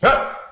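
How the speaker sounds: a short loud fake cry to startle; sudden mock yell. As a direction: bark out a sharp Ah to make him jump. Coach yells to startle student.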